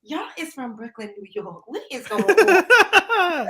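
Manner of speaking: quoted Southern speech breaking into a loud finish; Southern accent. Retold or imitated. Woman quoting people's reaction to her family, mock Southern accent. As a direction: imitate Southerners puzzled by the switch. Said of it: "a Southern accent".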